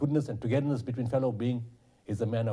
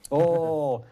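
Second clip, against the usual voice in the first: a sudden loud, higher "Oh" of alarm; 6 semitones higher, 8 dB louder than usual. Retold or imitated. Retelling the cancelled meeting to the interviewer, alarmed. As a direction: sound the alarm bells going off.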